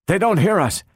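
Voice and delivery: high, urgent gasp of realization; gasped dismay. A narrator's reading. Donovan realizes their shouted answers go unheard, gasped dismay. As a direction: blurt it out high, with mild alarm. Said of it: "gasped Donovan".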